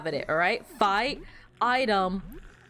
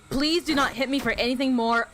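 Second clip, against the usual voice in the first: ordinary delivery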